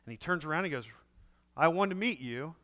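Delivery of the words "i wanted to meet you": eager greeting. Retold or imitated. Father eager to meet.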